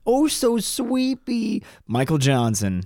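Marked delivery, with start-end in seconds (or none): 0.1-1.6 put-on slow, high baby-talk lisp; mock childish cartoon voice. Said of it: "Why are you talking like that?".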